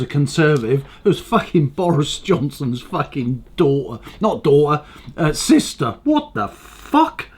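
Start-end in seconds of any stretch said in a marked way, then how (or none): 6.0-7.3 incredulous. Real, not acted. Disbelief at Johnson's sister.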